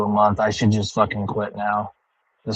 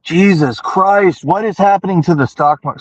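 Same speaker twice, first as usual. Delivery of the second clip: shocked disbelief; 8 semitones higher, 5 dB louder than usual. Real, not acted. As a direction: gasp it out like the floor dropped away. Sees the stock market crash.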